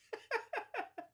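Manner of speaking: a burst of high laughter. Real, not acted. Vlogger laughing at her own joke to viewers, high laugh.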